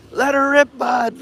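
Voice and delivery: yelling like a Canadian boat buddy; Canadian accent. Retold or imitated. Shouting over the boat motor to the driver, mock Canadian accent. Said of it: "a really Canadian, uh, accent".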